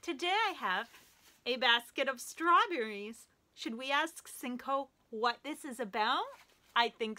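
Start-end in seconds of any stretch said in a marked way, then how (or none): none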